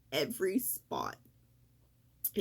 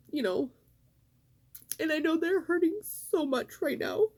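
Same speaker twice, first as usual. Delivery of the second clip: real tearful voice, higher and breaking; crying; 7 semitones higher than usual. Real, not acted. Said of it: "I was gonna try not to cry".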